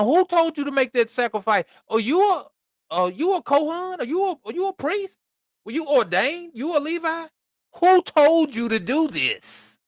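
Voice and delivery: high, pressing questions from an offended prophet; mock stern rebuke. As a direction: grill him like an angry prophet. Samuel scolding Saul, voiced.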